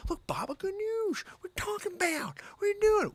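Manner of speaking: high comic impression voice; put-on high voice. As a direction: squeak it out in disbelief.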